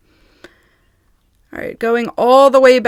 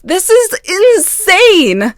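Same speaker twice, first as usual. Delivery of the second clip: slow, high and louder, drawn out in shock; stunned disbelief; 9 semitones higher, 5 dB louder, much slower than usual. Real, not acted. Reading her stalker's messages aloud, stunned and drawn out. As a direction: let each word hang in real disbelief.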